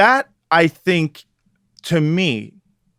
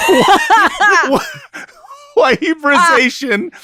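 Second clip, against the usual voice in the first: laughing disbelief; 12 semitones higher, slower than usual. Real, not acted. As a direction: cry out in amused disbelief.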